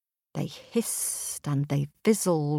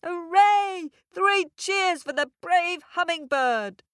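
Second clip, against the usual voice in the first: much higher, loud cheer; joyful cheering; 11 semitones higher, 3 dB louder than usual. A narrator's reading. Forest animals cheering the hummingbird, high joyful shout. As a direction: cheer like a crowd of happy animals. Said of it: "the animals jumped for joy".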